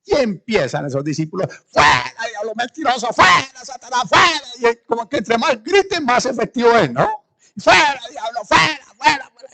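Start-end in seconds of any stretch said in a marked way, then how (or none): 1.7-4.7 high, loud commanding yells; mock deliverance shouting. Disciples yelling at the demon, high hammed-up commands. 7.6-9.5 high, loud commanding yells; mock deliverance shouting. Disciples yelling at the demon, high hammed-up commands.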